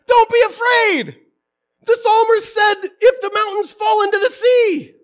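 high, loud self-scolding; sustained high shout, berating himself; yelling at himself. Acted. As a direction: yell it as a frantic talking-to to yourself. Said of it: "I'm yelling at myself".

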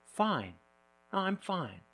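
quiet, clipped-off reluctant answer; curt fine. Retold or imitated. Asked how they are, the quiet one mutters fine. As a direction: answer like a quiet person brushing you off. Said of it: "And they answer".

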